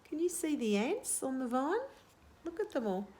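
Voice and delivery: usual speaking voice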